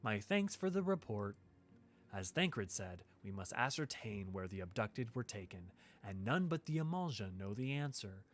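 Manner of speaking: soft, lightly voiced noble lady; a gentle, measured quest-giver; Minfilia voice. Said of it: "Love your Minfilia voice".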